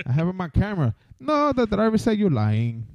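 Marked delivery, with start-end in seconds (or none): 1.2-2.9 slow, higher mock Indian call-centre agent; mock call-centre accent. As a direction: play the Indian agent politely stonewalling.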